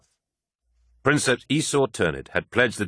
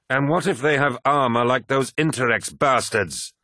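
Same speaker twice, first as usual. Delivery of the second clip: raised pitch, combative question; demanding challenge; 6 semitones higher, 3 dB louder than usual. A narrator's reading. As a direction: demand an answer from your comrade. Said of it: "demanded Abaddon".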